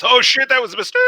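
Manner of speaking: high, loud mock falling yell; mock panicked regret. Imagined jumper regretting it seconds before death, high panicked yell. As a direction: cry out in sudden pretend panic, pitch way up.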